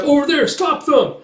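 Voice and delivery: high, loud alarm call; shouted order. Retold or imitated. Soldiers spot the escapees, shouted order. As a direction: direct men to intercept.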